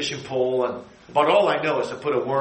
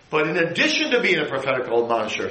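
ordinary talk

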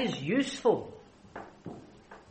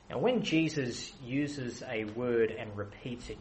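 ordinary talk, nothing marked

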